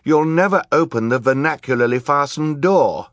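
put-on high, loud child's challenge; defiant high-pitched child; Violet. A narrator's reading. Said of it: "Violet said".

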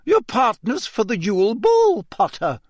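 high, cool and curt; cold. Acted. Teacher correcting Harry about the ball, coldly. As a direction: chill the words with impatience. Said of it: "she said coldly".

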